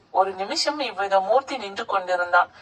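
plain reading voice

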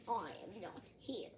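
low, quiet grumble from bed; drowsy, reluctant low voice; sleepy Toad. A narrator's reading. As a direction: answer softly and low, wanting to be left alone.

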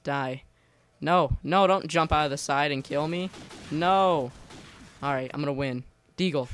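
ordinary talk

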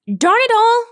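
high, loud exasperated cry; frustrated shout. A narrator's reading. Rainbow Dash shouting as she wrestles a minotaur, exasperated shout. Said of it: "she shouted".

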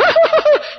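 high, stuttering whooping laugh; imitated shouting laugh. Storyteller showing how his friend shouted, high laughing outburst. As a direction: burst into a mimicked giddy cackle. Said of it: "he shouted".

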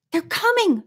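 ordinary delivery, nothing marked

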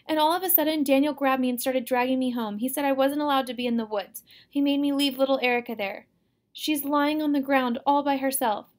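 wailing, accusing tale; crying complaint. A narrator's reading. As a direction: pour out the wrongs to mother in distress. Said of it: "she cried".

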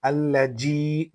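"আল্লাযী" with a hard English j for the dh, long final ee; wrong j sound. Shown as a demonstration. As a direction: mispronounce "আল্লাযী" as al-la-jee. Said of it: "বলা যাবে না". ("আল্লাযী"-র যাল-কে জিমের মতো ভুল উচ্চারণ)

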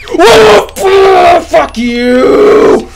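high shriek into a drawn-out yell; scream, then curse loudly; scared scream. Real, not acted. Gamer cursing what scared him, scared scream.

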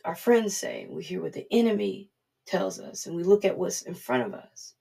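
ordinary delivery

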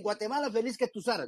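"Guatemala, feliz que tus aras" run together softly in one breath, no pause after "feliz"; run-on recital. Shown as a demonstration. Preacher mimicking how everyone rushes the anthem, softly run together. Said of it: "todos dicen".

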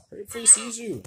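high panicked urging. Real, not acted. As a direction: push them to hurry.

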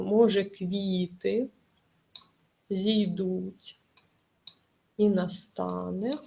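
slow scansion, no stress on Може, stress on квіти; scanning verse aloud. Shown as a demonstration. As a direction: recite Може, квіти зійдуть with pauses between words to show the meter. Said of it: "ми не ставимо на ньому наголос, ставимо в наступному".

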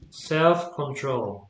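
Self control said very slowly; slow model phrase. Shown as a demonstration. Teacher reads example slowly to student. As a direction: stretch Self control out for the learner. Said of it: "teacher models compound nouns".

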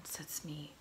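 plain reading voice

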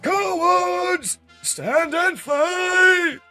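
high, drawn-out enraged scream; villain's furious shriek; screaming at deserters. Acted. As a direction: scream at fleeing cowards at full volume. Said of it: "Shockwave screams at them".

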